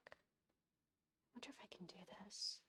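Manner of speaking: unvoiced slow whisper to herself. Real, not acted. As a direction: breathe it out under her breath. Muttering to herself while working.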